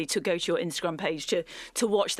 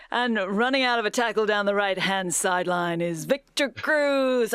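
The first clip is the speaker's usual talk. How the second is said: put-on American accent; 3 semitones higher, 4 dB louder than usual. Host imitating an American commentator on the Victor Cruz replay in an American accent. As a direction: call it like an American football announcer. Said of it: "I'm gonna try my American accent".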